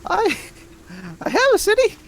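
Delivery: very high, odd little creature; squeaky creature voice. Acted. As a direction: squeak every line way up high. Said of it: "inconsistent yelling".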